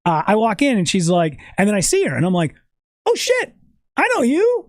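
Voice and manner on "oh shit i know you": excited recognition. Retold or imitated.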